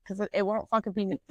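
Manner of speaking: usual speaking voice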